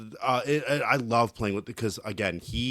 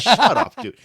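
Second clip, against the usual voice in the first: high, loud mock bark; imitated harsh shut-up; 10 semitones higher, 9 dB louder than usual. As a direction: mimic a teammate's sudden outburst. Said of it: "told me to shut up like that".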